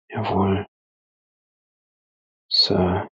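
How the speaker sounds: ordinary delivery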